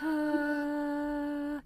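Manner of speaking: creaky fry held on a note; vocal fry attempt. Shown as a demonstration. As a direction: try the fry exercise. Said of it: "try and mix vocal fry".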